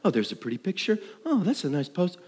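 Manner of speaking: fast, airy mock Facebook browsing; a light, breezy mock scroll; carefree voice of someone scrolling a feed. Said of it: "You're, like, just careening along".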